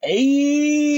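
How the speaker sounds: high, long-held happy call; real delighted whoop of a word; excited cheer. Real, not acted. Cheers at finding the store.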